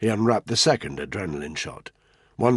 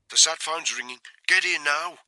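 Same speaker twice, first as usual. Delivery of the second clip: higher-pitched urgent summons; urgent radio call; 9 semitones higher than usual. Acted. Urgent earpiece call from Tony.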